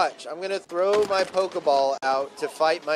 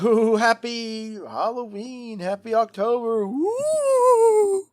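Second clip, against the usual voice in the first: spooky voice; 9 semitones higher, 6 dB louder, much slower than usual. Pretend. Spooky drawn-out greeting.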